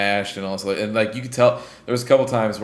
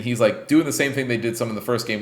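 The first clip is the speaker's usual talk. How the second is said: ordinary talk, nothing marked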